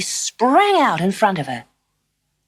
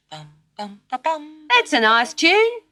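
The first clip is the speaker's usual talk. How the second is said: kangaroo voice; 9 semitones higher, 3 dB louder, much slower than usual. A narrator's reading.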